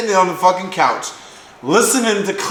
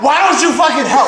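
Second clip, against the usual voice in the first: higher, louder, laughing fake yell; retold yell; 9 semitones higher, 5 dB louder, faster than usual. Retelling yelling at Trevor on the couch, laughing mock yell. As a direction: bark it like the fight again, half laughing.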